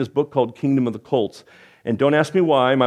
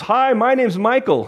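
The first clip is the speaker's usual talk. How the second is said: put-on eager teen; 9 semitones higher, slower than usual. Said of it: "turned around, goes".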